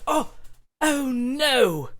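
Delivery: high, loud startled British dad; flustered British father caught off guard; British accent. Acted. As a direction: yelp it in a fussy British accent. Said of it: "why do you have such an interesting accent".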